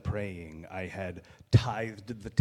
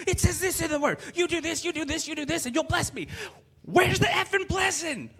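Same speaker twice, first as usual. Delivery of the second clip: screamed, much higher and louder, furious; cursing God out; 6 dB louder than usual. Retold or imitated. Man screaming curses at God in a parking lot. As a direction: rage at the sky, full throat. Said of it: "I literally said".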